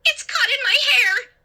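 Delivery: high, panicked stammering cry; falter, then cry out in horror; frantic high-pitched wail. A narrator's reading. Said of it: "I cried".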